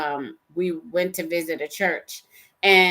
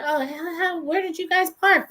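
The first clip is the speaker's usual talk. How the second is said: friendly church lady; 10 semitones higher than usual. Retold or imitated. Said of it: "she was just like".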